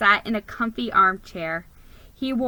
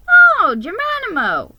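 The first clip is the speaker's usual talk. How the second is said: much higher and louder, delighted; beaming welcome; 11 semitones higher, 10 dB louder than usual. Acted. Mrs Claus greets the mouse, beaming with joy. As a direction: greet him like a long-awaited guest. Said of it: "she beamed".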